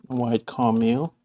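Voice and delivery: ordinary talk, nothing marked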